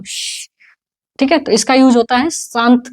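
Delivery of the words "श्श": quiet voiceless "shh"; breath-only shh; demonstrated shush. Shown as a demonstration. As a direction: model the hushing sound softly. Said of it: "ऐसा बोलते हैं ना हम".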